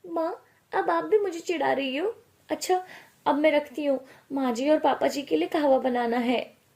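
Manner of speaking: high, mildly huffy daughter; teased daughter. Acted. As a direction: protest to mum, then wrap up the call.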